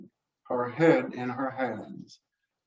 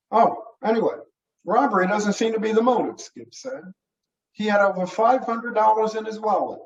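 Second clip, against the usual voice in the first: put-on keen young deputy; 6 semitones higher, faster than usual. Young deputy telling the sheriff it was no robbery, high keen voice. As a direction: argue the point at a raised pitch.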